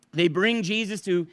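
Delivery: usual speaking voice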